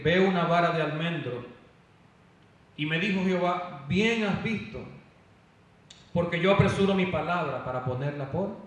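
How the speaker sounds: forceful scripture proclamation. Real, not acted. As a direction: read the verse out powerfully to the congregation. (lectura bíblica proclamada en voz alta y con fuerza)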